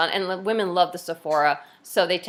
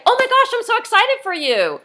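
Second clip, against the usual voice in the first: very high, loud, gushing excitement; demoing an over-the-top excited reply; excited squeal; 13 semitones higher, 7 dB louder than usual. Shown as a demonstration. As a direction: squeal it like you're thrilled for a friend. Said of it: "I would say".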